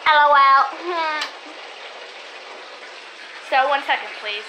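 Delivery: normal talking voice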